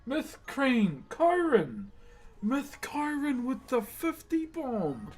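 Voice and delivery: high, drawn-out amazed exclamations; excited reaction. Real, not acted. Streamer reacting to a fifty bomb, excited. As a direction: hype up the donor, stretching each name.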